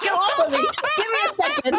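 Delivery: half-laughing plea for a moment. Retold or imitated. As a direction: push them off while laughing. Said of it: "I was like".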